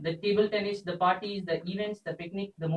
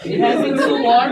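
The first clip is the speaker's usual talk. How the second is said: tearful goodbye; 6 semitones higher, 9 dB louder than usual. Real, not acted. Repeat of the faltering line.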